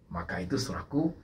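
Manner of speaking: normal talking voice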